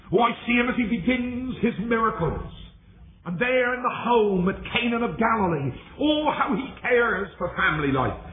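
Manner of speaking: high, ringing sermon delivery; impassioned preaching. Real, not acted. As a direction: preach it in a high, sustained declamation. Preaches at full force.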